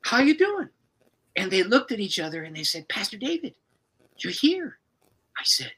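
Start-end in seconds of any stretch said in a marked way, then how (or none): 0.1-0.7 high bright surprised hello at the door; cheerful greeting. Retold or imitated. Pastor greeting a couple at their door, cheerful and high. 2.9-4.8 high astonished exclamation; can't believe he's standing there; amazed. Retold or imitated. Wife amazed to find pastor at her door, high and astonished.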